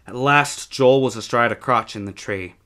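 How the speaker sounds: plain reading voice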